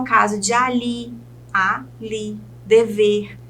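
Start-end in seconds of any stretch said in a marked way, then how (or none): 1.5-2.4 "ali" split into a, li; syllable by syllable. Shown as a demonstration. Teacher counts syllables for students, "ali" syllable by syllable. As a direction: say "ali" as two separate syllables. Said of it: "Se ela tem duas sílabas".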